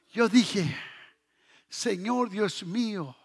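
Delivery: usual speaking voice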